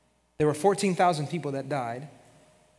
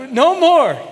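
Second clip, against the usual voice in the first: loud, high cry of no more; pleading cry; 11 semitones higher, 12 dB louder than usual. Retold or imitated. Terrified crowd crying out to God, loud and high. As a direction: beg loudly for it to stop.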